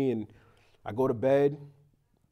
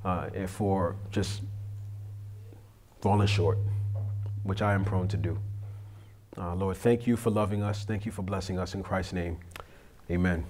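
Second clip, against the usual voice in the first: prayer; 6 semitones lower, 4 dB quieter than usual. Real, not acted. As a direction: pray humbly and haltingly, asking forgiveness. Leads an opening prayer.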